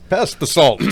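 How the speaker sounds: booming announcer over dinner; radio voice. Pretend. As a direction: ask for the salt like a broadcast. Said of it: "start talking like that everywhere".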